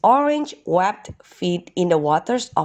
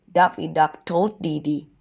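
usual speaking voice

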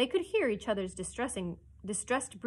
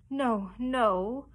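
mildly frightened refusal, louder; crying out; 3 semitones higher, 5 dB louder than usual. A narrator's reading. Cecco cowers before his captain, mild cry. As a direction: cry out in protest.

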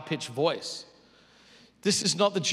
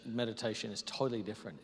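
normal talking voice

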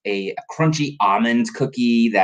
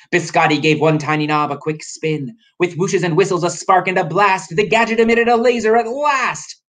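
rhymed narration climbing higher; story narration; 8 semitones higher than usual. A narrator's reading. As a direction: build the suspense up to a high peak. Story narration building to the blast.

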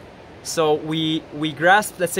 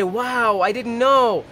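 mock amazement; 5 semitones higher, slower than usual. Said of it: "you hear a lecture on it and you say".

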